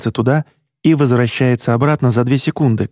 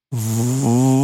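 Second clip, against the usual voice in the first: drawn out. Acted. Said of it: "тяну я".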